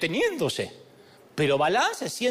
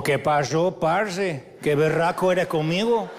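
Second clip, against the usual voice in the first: put-on Colombian accent; 3 semitones lower, slower than usual.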